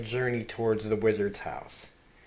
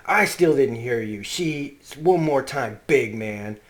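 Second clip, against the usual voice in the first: louder, daring him to say it again; loud demand; 2 semitones higher, 7 dB louder than usual. Retold or imitated. Father daring his son to repeat it, loud. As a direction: bark it as a dare.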